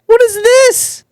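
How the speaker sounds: very high, incredulous cry; freaked-out, high-pitched outburst; exasperated disbelief. Real, not acted. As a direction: blurt it out way up high. AI will not stop acting strangely.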